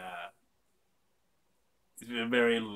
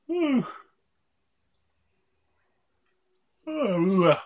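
loud whew; 10 semitones higher, 11 dB louder than usual. Real, not acted. Big exhale after drinking beer.

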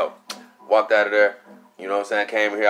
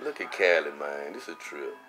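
hushed awe; 8 semitones lower, 11 dB quieter than usual. Retold or imitated. Quiet awe, staring at the doll.

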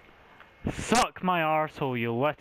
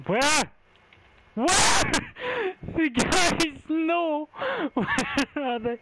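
high panicked laughing shout; scared horror game streamer; 4 dB louder, slower than usual. Real, not acted. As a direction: laugh in panic while searching.

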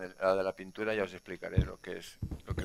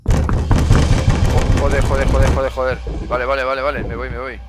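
panicked cursing; 6 semitones higher, 19 dB louder, slower than usual. Real, not acted. Jump scare, falling fruit.

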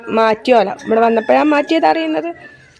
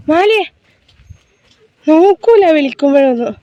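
calling out; 4 semitones higher, 6 dB louder than usual. Real, not acted. Mother calling her son who won't look, raised voice. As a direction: call him across the room.

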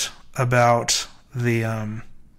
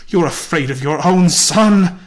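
loud, high cry while struggling; accusing cry; 6 semitones higher, 8 dB louder than usual. A narrator's reading. Mother struggling against fearful husband, crying out. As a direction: fling the accusation at him. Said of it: "she cried, struggling".

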